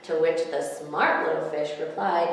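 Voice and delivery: ordinary delivery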